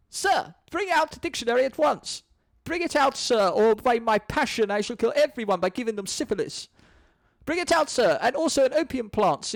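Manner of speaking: high, hammed-up demanding poets; mock theatrical demand; pompous poets. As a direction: bellow at the door like a pompous poet. Poets demand dictionary outside door.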